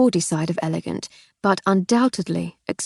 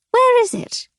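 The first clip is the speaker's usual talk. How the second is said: put-on deaf old aunt; 4 dB louder than usual.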